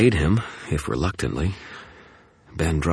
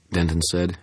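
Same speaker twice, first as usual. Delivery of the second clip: normal talking voice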